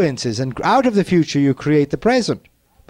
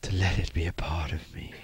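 whisper; 10 semitones lower, 12 dB quieter than usual. Acted. Meditative inner affirmation.

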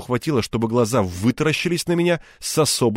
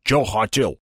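put-on curt question in a Caucasian accent; thick-nosed stranger with Caucasian accent; slower than usual. A narrator's reading. Man at garage door challenging Egor, Caucasian accent. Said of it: "произнёс обладатель носа с характерным акцентом".

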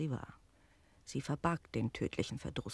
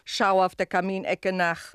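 hostess calling back over her shoulder; called answer; 4 semitones higher, 8 dB louder than usual. Acted. Landlady answering the servant, raised voice. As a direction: toss the answer across the room.

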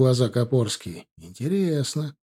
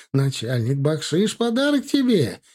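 climbs much higher at "подарок тебе"; fawning merchant; 3 semitones higher than usual. Acted. Merchant offers officer a bribe, fawning high climb. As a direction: sweeten the bribe eagerly.

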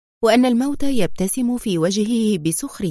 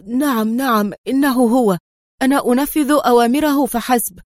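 urgent, higher-pitched pleading; begging cry; 3 semitones higher, 4 dB louder than usual. A narrator's reading. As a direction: protest desperately, begging to be believed. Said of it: "هتف الرجل في توسل". (هتاف متوسل)

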